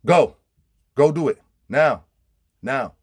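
loud, high commands; shouted orders to a friend; barked order. Retold or imitated. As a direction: order him to go, no arguing. Ordering him to apologize.

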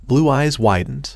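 ordinary delivery, nothing marked

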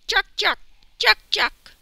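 high, loud monkey shrieks; sharp, high paired monkey calls; monkey chatter. A narrator's reading. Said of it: "A loose-limbed monkey".

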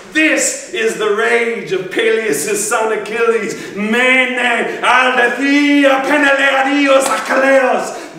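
high, loud, slow bardic proclamation; impassioned epic declamation. Acted. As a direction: cry out the ancient verse at full force. Proclaims the epic's opening, partly in Greek.

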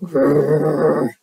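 low grumbly storybook growl; bear growl. Acted. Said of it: "growled Bear".

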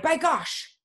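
high-pitched exclamation; indignant cry. Acted. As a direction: exclaim in acted shock at the price. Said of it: "She cried".

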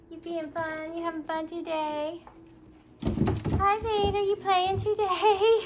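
high laughing puppy talk. Real, not acted.

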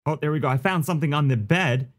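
ordinary talk

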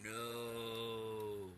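a dark, otherworldly no; demonic no. Retold or imitated. Voice refusing the narrator's leaving, mock demonic no. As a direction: growl a single no like something old and evil. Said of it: "I heard a real demonic voice".

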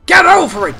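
high, very loud command; real exasperated yell; angry shout. Real, not acted. Player ordering the stuck character over, angry shout.

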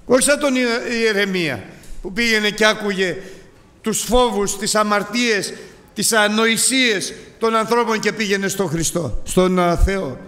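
high, loud, sustained fervour; fervent preaching. Real, not acted. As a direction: preach it at full force. Preaching at full force. (φλογερό κήρυγμα)

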